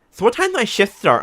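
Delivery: high, loud camp impression; mocking put-on effeminate voice.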